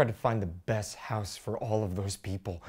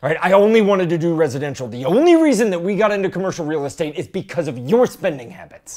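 shouting; 6 semitones higher, 13 dB louder than usual. Acted. Husband arguing back loudly.

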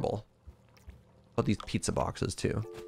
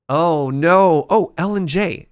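loud dismay; 3 semitones higher, 8 dB louder, slower than usual. Real, not acted. Gamer dismay at controls.

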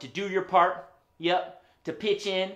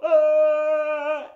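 long, high, loud "aah"; silly open-mouthed held aah; tongue-out "aah"; 10 semitones higher, 10 dB louder than usual. Real, not acted. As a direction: stick out your tongue and go aah. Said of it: "stick out your tongue like this".

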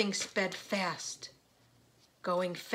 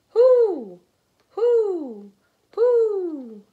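loud, high, long owl hoots; owl hooting; 11 semitones higher, 15 dB louder, much slower than usual. A narrator's reading. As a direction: hoot out high and falling like an owl. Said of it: "an owl says".

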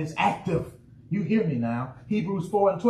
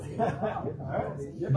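chuckle; 2 semitones lower, 7 dB quieter than usual. Real, not acted. Teasing congregation scared to say.

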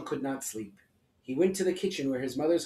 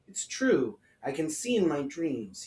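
usual speaking voice

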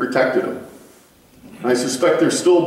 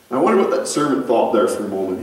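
normal talking voice

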